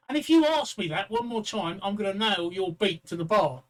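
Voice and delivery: fed-up barman. Acted. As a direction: lay down a mock-angry final warning.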